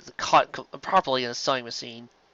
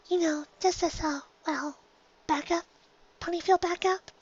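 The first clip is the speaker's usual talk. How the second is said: much higher, softer shy little voice; Fluttershy timid; 6 dB quieter than usual. Acted. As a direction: barely dare to speak up.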